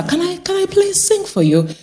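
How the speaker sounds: high-pitched, eager asking; pleading request. Retold or imitated. Carer asking permission to sing.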